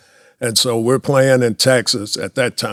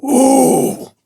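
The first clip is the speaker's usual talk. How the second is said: high loud mock crowd ooh; imitating the crowd reacting to a strikeout; 6 dB louder than usual. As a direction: mimic a stadium groan.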